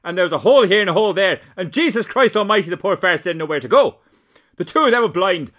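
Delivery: furious Irish brogue, played for laughs; Dowley in Irish accent. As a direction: rant it in a broad Irish accent. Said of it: "vanish into the Irish dialect".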